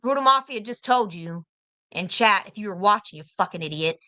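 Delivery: usual speaking voice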